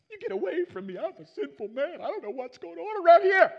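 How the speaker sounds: high, panicky acted plea building to a yell; fearful outburst. Peter to Jesus after the huge catch, acted fearful outburst. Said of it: "Peter fears for his life".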